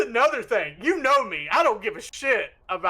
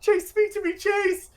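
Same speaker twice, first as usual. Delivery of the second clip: higher and higher pleading calls; calling out desperately; 11 semitones higher, much slower than usual. Retold or imitated. A character calling for fallen Chase, desperate and higher. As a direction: cry out his name, desperate. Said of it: "all you hear is".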